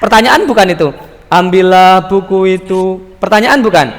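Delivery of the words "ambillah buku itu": monotone "Ambillah buku itu", every syllable level; tanpa nada. Shown as a demonstration. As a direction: chant "Ambillah buku itu" on one pitch. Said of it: "nggak pakai nada". ("Ambillah buku itu" lurus tanpa intonasi)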